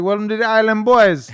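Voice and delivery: high, loud island greeting; hammed-up host announcing to the islanders; mock TV host welcome. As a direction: boom it out like a show host. Voices Lou Dobbs as islander.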